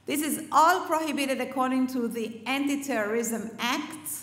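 ordinary talk, nothing marked

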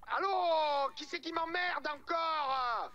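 high, drawn-out annoyed hello; irritated phone answer. Acted. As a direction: pick up loudly, fed up at being bothered.

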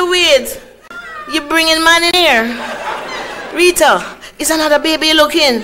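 high, loud scolding auntie; auntie's outraged voice. Retold or imitated. Auntie scolding her niece for sneaking a man in. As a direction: shriek it like a shocked auntie. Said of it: "So she said".

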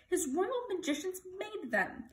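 high, urgent panted explanation; panting. A narrator's reading. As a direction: pant out the news, pitched high. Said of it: "panted Bartholomew".

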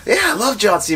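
high-pitched re-enacted enthusiasm; excited agreement. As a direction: chime in like a pleased fan. Re-enacting his delighted reply to wife.

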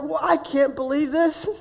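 can hardly contain the excitement, slowly; excited disbelief. Retold or imitated. Man greeting a friend's wife by the van, excited disbelief. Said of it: "What is he so excited".

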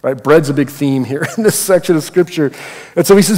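ordinary talk, nothing marked

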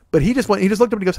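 ordinary talk

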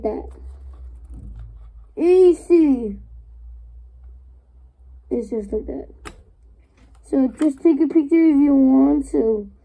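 muffled behind face mask; speaking inside breathing mask; through an oxygen mask. Real, not acted. Seen 5: Green oxygen mask strapped over mouth and nose throughout speech.

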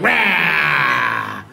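sudden loud roar. Pretend. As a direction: burst from sweet into a mock roar. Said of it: "supposed to be all sweet and".

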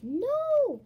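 loud, high drawn-out yell; full-voiced high shout; furious shout. A narrator's reading. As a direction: yell in outraged protest. Villain's cry as heroes escape.

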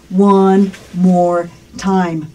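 word by word, drawn out; very slow model phrase. Shown as a demonstration. Teacher modelling English for learners to repeat, very slow. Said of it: "Una vez más".

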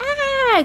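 high-pitched mock shriek; imitated ad scream. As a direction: mimic a horror-ad scream. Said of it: "they're just ladies screaming".

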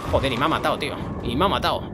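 ordinary talk, nothing marked

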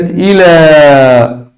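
إلى with a long held final "aa"; drawn-out test word. Shown as a demonstration. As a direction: present إلى slowly, stretching its end. Presents test word to students.

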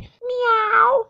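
a drawn-out meow; cat meowing at the postman. Acted.

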